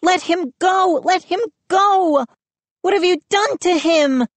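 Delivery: very high, urgent pleading; frantic shriek. A narrator's reading. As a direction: shriek at him in panic. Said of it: "I heard Mindy shriek".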